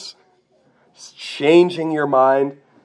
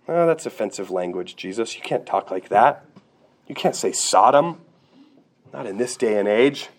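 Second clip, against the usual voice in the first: lower-pitched mock protest; offended objector; 7 semitones lower than usual. Modern listener objecting to Jesus's language, in a low mock-offended voice. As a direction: put on a lower voice of prim disapproval.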